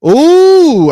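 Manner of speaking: loud, high, drawn-out "ooh"; soaring match-day exclamation; mock commentator gasp. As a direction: cry out like a commentator at a near miss. Commentator reacting to a shot.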